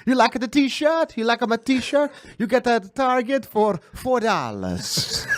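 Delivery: put-on high-pitched, slow comic Italian accent; hammed-up Italian accent. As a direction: play a proud Italian dad in broken English. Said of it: "this is my Italian accent".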